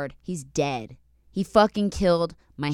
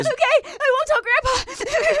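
put-on high, wobbly little-kid voice, much higher; crying child voice. Promising grandma through tears, as a tearful kid. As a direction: sob it out like a young child. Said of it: "I'm like".

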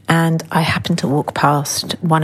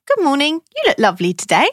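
higher, sing-song greeting to a friend; friendly compliment; 8 semitones higher, faster than usual. Retold or imitated. Greeting a best friend, a sing-song compliment. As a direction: lift the good morning warmly. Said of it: "Or maybe you'd say to them".

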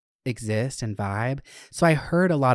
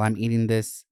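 usual speaking voice

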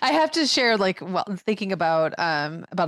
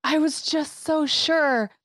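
put-on mortified kid; 8 semitones higher, slower than usual. Mortified child quoted.

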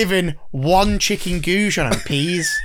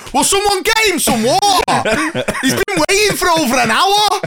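loud high mock shout; 12 semitones higher, 4 dB louder than usual. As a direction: bellow across the ward on his behalf, laughing. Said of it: "the scrote in the bed opposite was like".

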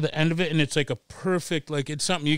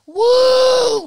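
high, loud whooping cheer; crowd cheer; 10 dB louder than usual. Retold or imitated. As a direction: whoop like a wild club crowd.

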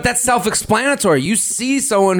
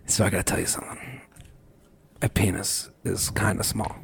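hushed confession; 12 semitones lower, 12 dB quieter than usual. Pretend. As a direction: murmur it like a shy secret. Acting out an awkward confession.

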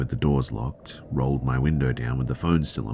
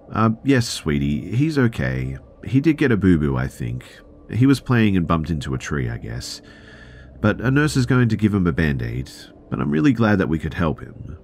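put-on higher, louder cheerful reassurance for a child; smooth it over so she isn't scared; soothing mum; 6 semitones higher, 4 dB louder than usual. Mother soothing her little daughter.

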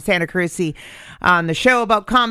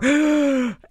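starstruck gasp; 9 semitones higher than usual. Retold or imitated. Starstruck at seeing Bill Burr.

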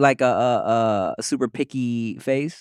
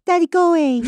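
put-on toddler voice; 5 dB louder than usual. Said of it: "She goes".

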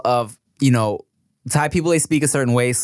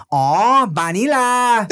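put-on high laughing Thai-accented vanilla; mock the cousins' Thai pronunciation; 8 semitones higher, 3 dB louder, slower than usual. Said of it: "They were like".

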